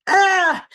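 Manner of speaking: sharp high cry of upset; anguished cry. Retold or imitated. Recalling getting upset at the words, a sharp high cry. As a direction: let out a short, pained high "Ah!". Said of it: "I'm like".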